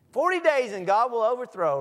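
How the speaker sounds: belting the warning out loud and high; loud doom proclamation. Retold or imitated. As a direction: proclaim doom like a street preacher. Jonah preaching doom.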